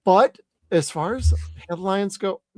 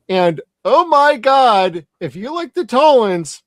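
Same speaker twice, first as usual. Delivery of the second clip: excited; 4 semitones higher, 8 dB louder than usual. Real, not acted. Excited episode plug.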